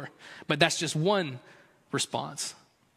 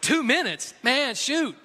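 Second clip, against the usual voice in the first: higher, winded disbelief at the treadmill; mock outrage, pitched up and incredulous; exasperated runner; 6 semitones higher than usual. Runner on a treadmill griping at the counter, exasperated and higher. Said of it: "I'm like".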